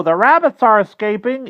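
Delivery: high, loud crow alarm; screaming crow. A narrator's reading. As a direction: raise the alarm in a shrieky bird voice. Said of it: "screamed the crow".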